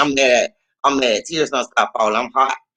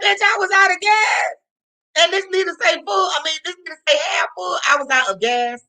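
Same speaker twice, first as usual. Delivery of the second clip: outraged disbelief. Retold or imitated. Ranting at the mechanic, high and outraged. Realizing she was out of gas.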